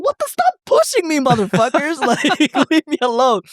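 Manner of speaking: high, put-on annoyed whine; exasperated mock protest. Teller to pushy crowd in Madrid, high exasperated mock complaint. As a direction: play up being fed up with the shoving. Shoved by crowd, retelling his reaction.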